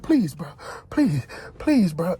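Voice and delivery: mock begging. High man begging.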